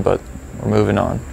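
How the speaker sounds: ordinary delivery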